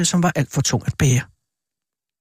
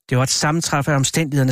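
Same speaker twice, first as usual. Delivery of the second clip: usual speaking voice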